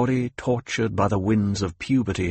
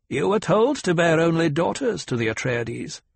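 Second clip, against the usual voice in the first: put-on higher, stern old woman; a demanding elder questioning her pupil; old Reverend Mother; 7 semitones higher than usual. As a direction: severe old matriarch.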